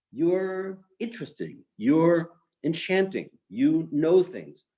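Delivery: acted, lingering appreciation; imagined admiration. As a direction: draw out each compliment as if discovering it. Rabbi's imagined kinder reply.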